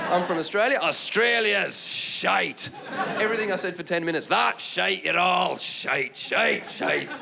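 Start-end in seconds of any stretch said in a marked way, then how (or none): 0.8-2.7 very slow, stretched Scottish heckle; Edinburgh heckler. Retold or imitated. Edinburgh heckler answering the Australian comic, mock Scottish accent. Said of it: "One was in Edinburgh". 4.3-7.1 repetitive mock Scottish heckling; Edinburgh heckler. Edinburgh heckler rubbishing every line of the act, mock Scottish accent. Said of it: "One was in Edinburgh".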